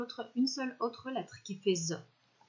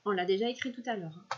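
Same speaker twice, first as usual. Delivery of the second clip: slow checking; much slower than usual. Shown as a demonstration. Teacher checking the sentence word by word, slow. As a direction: stretch each word slowly with pauses. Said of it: "on va écrire la phrase".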